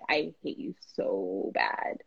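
hushed, slow, sulky whisper of a reluctant sister; whispered grumble. Retold or imitated. Sister grumbling at photographer, mock sulky whisper. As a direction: breathe it out low and slow like a grudging pregnant sister. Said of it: "that day she was like".

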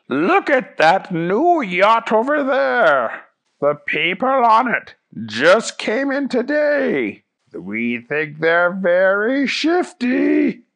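high, slow cartoon voice; Fish Guy. Fish Guy points out a shifty yacht, slow and high. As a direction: stretch it out, high and conspiratorial. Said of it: "when Fish Guy said".

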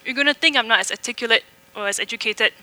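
demonstrating a strong Malaysian accent. Shown as a demonstration. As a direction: give the sermon in a Malaysian English accent. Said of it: "I talk like this with a Malaysian accent".

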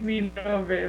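ordinary delivery

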